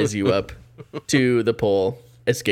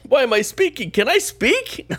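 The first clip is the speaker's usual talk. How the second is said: squeaky confused voice; 14 semitones higher than usual. Pretend. Voicing confused NPC.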